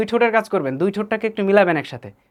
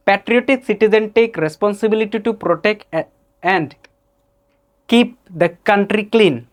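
put-on Indian accent; 2 semitones higher, much slower than usual. Teacher mimics an Indian friend reading aloud, Indian accent. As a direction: read it slowly in an Indian accent. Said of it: "This is an Indian accent".

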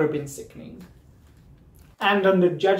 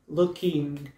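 slow roast delivery; much slower than usual. Acted. Slow drawn-out roast line.

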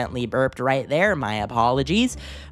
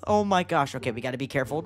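normal talking voice

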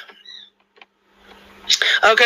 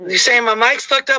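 ordinary talk

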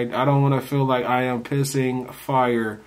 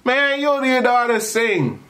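scoffing; 11 semitones higher than usual. Retold or imitated. Mocking cousin's bet.

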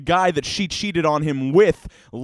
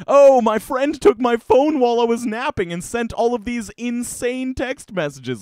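higher, lightly voiced girly excuse; retelling the ex's excuse, lightly mocking girly voice; 8 semitones higher, 4 dB louder than usual. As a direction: mimic her lame excuse. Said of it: "she's like".

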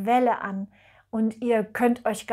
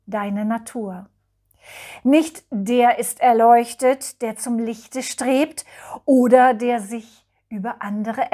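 solemn, measured verse with pauses between lines; poem recitation; 5 dB louder than usual. Acted. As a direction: recite a blessing poem, each line starting strong and falling away. (feierlich vorgetragenes Gedicht)

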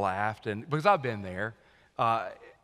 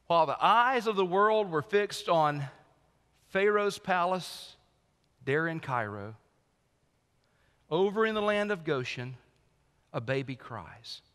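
preaching; 5 semitones higher than usual. Acted. Pastor paints the nativity-style contrast for the congregation, building preaching. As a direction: swell the phrase, then let it fall hushed on a baby cries.

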